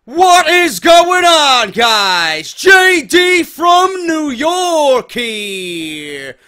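loud, drawn-out hype host shout; hype announcer intro. Acted. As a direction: bellow it like a wrestling announcer. Hyped show intro announcement.

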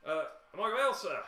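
high, loud, hesitant servant; eager but unsure innkeeper; hesitant bartender. Acted. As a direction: bartender fawning over a soldier. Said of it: "he pauses, he says".

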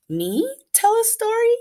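high delighted surprise; light up at the idea; surprised chicken. Acted. Chicken asked to tell a story, high surprise.

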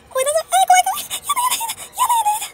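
terrified shrieks. Real, not acted. Monster appears, "声出そう.

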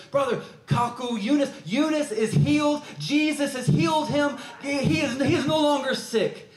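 high, loud, bursting with the news; joyful shout. Retold or imitated. Young brother calling out that Eunice is healed, joyful shout. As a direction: shout it like a miracle just happened.

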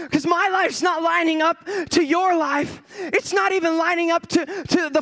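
urgent re-enacted prayer, pitched high; crying out to God. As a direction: plead loudly with God from the depths. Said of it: "I'm crying out to the Lord".

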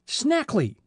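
the detective jolts at a known name; startled exclamation. A narrator's reading. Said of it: "exclaimed Fenton Hardy".

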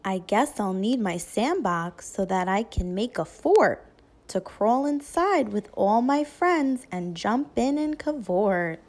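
ordinary delivery, nothing marked

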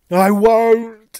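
high, very loud anguished cry; sobbed wail. A narrator's reading. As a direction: wail it out as if sobbing. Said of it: "he sobbed".

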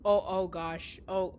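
normal talking voice

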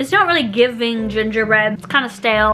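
eating while speaking; talking while chewing. Real, not acted. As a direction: mouth partly full of popcorn. Seen 1: Popcorn put in mouth at -2.0s; jaw and lips keep chewing while talking.

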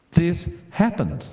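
slow, weighty, every word placed; slow insistence. Real, not acted. Preacher assuring the congregation the event was real, slow and weighty. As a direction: drive home that it is true.

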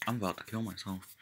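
soft remark to oneself; quiet aside. Real, not acted. Muttered joking aside after stumbling.

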